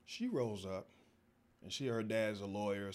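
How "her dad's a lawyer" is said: ordinary talk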